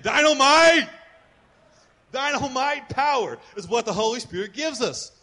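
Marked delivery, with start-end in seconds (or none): 0.0-0.9 loud, high shout of the word; shouted call. Shown as a demonstration. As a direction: belt it out for the crowd. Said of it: "turn to your neighbor and say".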